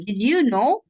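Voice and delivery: Did you know? rising at the end like a question. Shown as a demonstration. Teacher showing a student a rising question. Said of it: "So make it like a question".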